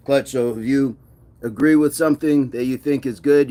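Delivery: normal talking voice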